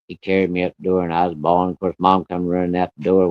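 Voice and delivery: usual speaking voice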